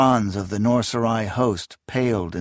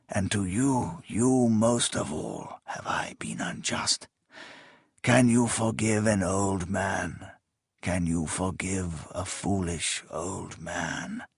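faint dying plea; 4 semitones lower, 8 dB quieter, slower than usual. Acted. Dying king begs forgiveness.

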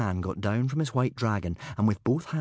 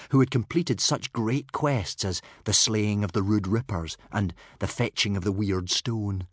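ordinary delivery, nothing marked

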